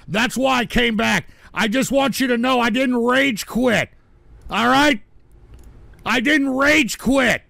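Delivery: loud, high, insistent denial; loud insistence. Real, not acted. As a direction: shout it down, you did not quit.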